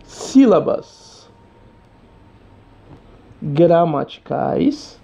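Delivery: slow, each word set apart with long pauses; deliberate teacher summary, slow and spaced; slow emphatic answer. Shown as a demonstration. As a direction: lay out the answer word by word, very slowly.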